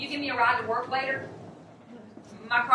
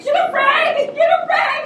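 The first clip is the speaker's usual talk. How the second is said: panicked shout; 10 dB louder, slower than usual. Acted. Panicked friend screaming for help.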